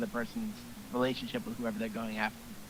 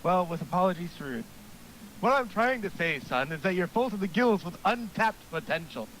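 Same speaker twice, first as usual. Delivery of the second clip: lightly raised coach voice; eager gym coach pep talk; hearty coach voice; 6 semitones higher than usual. Acted.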